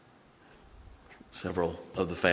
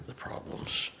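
hushed whisper; 11 dB quieter than usual. Acted. Hushed ending of preached line.

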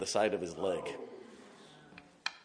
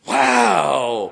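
impressed wow; 4 semitones higher, 14 dB louder than usual. Retold or imitated. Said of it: "I love E M Ts".

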